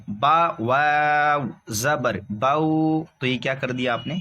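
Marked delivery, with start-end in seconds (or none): 0.1-3.0 باؤ with an over-lengthened glide at the end; wrongly stretched باؤ. Shown as a demonstration. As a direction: draw out the final vowel of باؤ, held long. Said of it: "کھینچ دیا".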